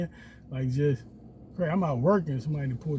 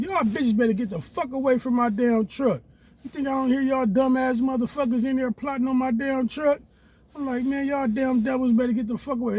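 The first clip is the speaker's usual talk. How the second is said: angry yell; 9 semitones higher, 4 dB louder than usual. Retold or imitated.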